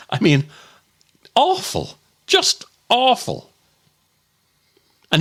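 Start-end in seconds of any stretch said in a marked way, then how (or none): 1.3-3.5 disgusted. Real, not acted. Disgusted recalling farm rats.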